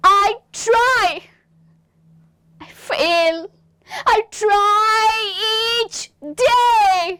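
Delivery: high, loud, crying out in anguish; show your pain. Shown as a demonstration. Second student's line, demonstrated by teacher with pained crying. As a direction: let the pain crack through each phrase. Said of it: "अपना दर्द दिखाओ".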